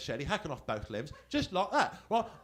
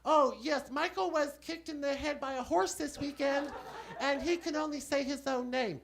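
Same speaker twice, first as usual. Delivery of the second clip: much higher, prim woman's voice; secretary voice; 11 semitones higher than usual. Acted. Comic poses as Caine's secretary to the scammer, high prim woman. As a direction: pitch way up as a fussy secretary. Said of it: "Barbara Johnson".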